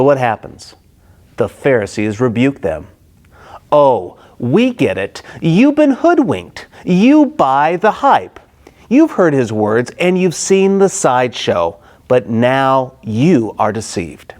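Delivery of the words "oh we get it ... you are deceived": mocking scorn. Pharisees jeer at temple police, mocking and sneering. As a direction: scoff at the gullible officers.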